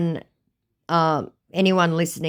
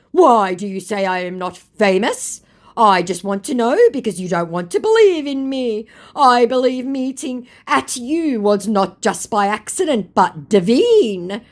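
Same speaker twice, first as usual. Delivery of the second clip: mock scammer; 9 semitones higher, 6 dB louder than usual. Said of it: "He actually said".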